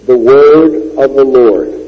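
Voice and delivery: slow, loud, weighty stress on word; emphasized phrase. Shown as a demonstration. Preacher lifting the holy phrase for the congregation, loud and slow. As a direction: lean hard on "The word of the Lord". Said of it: "the small little phrase".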